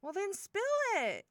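high, loud, eager demand; impatient urging. Acted. Big sister figure prodding brother's friend for news, impatient urging. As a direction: urge them to spill it, voice jumping up.

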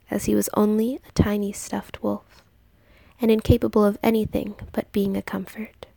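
ordinary delivery, nothing marked

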